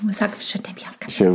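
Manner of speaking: whisper. Real, not acted. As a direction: breathe it as a secret. Coaxing friend to say phrase.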